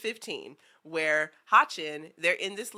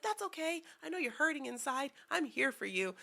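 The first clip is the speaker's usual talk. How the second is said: put-on tender boy; 8 semitones higher, 7 dB quieter than usual. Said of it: "he's just like".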